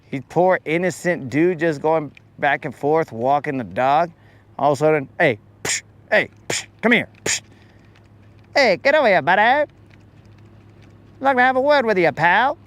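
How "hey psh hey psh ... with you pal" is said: higher, tough-guy mock threat; bully fish. Bully fish calling the lure over to rough it up, mock tough. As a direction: call him over like a playground bully.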